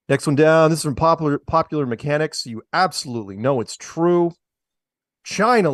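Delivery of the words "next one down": calls "Next one down" again, louder and clearer; repeating "Next one down" with more volume. Real, not acted. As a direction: say "Next one down" loud so the room hears.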